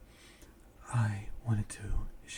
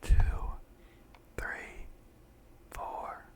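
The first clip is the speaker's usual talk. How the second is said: slow, mostly whispered breathing count; guided breathing meditation; 6 dB louder, slower than usual. Acted. Guide a friend through breathing in and out, hushed meditation voice.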